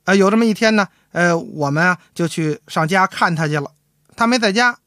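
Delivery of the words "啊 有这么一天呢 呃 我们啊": ordinary talk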